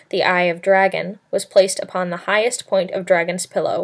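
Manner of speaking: ordinary delivery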